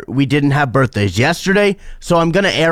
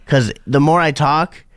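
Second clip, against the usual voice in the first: ordinary talk, nothing marked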